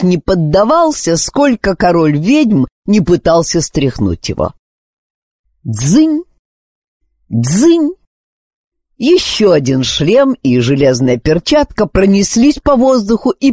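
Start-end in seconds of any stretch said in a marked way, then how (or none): 5.6-7.9 high ringing clang sounds; metallic clangs. A narrator's reading. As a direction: ring out like metal striking.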